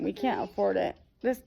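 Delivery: ordinary delivery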